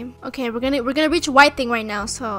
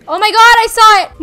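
excited shout; 7 semitones higher, 8 dB louder than usual. Real, not acted. Sees the monster appear.

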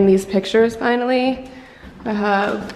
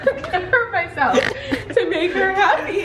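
higher, giggling through a fake sob story; laughing mock whine; 10 semitones higher than usual. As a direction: overplay the victim while laughing. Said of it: "The acting was amazing".

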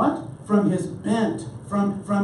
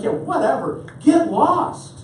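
mock-angry, jumping higher and louder at the end; retold scornful dismissal; 5 semitones higher, 3 dB louder, slower than usual. Wife to husband who claims he found Jesus, mock-scornful. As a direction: imitate a fed-up wife sending him packing.

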